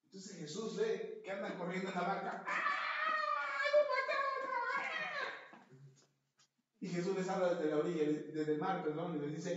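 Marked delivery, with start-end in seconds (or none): 2.5-5.7 long, high terrified wail; frightened cry from the boat; panicked scream. Acted. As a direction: wail in terror as the boat rocks.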